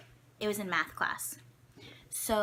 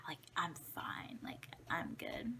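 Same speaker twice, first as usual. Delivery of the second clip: whispering that she's fine, much softer; whispered reassurance; 10 dB quieter than usual. Retold or imitated. Girl with a broken arm tells a classmate she's fine, whispering. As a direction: brush it off in a hushed whisper. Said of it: "And I'm like".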